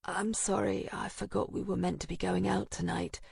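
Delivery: soft, weak rasp; rasping whisper. A narrator's reading. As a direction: barely get the words out. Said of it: "My voice is a rasping whisper".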